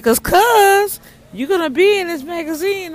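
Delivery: excited voice of the lady; excited retelling. Retold or imitated. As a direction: gush the good news. Quoting lady's exciting news.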